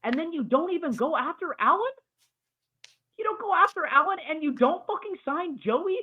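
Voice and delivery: high, strained outraged yelling; furious rant. Real, not acted. Podcaster raging at his team's front office, high furious rant. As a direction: let the disbelief boil over. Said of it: "That's the end of my crash out".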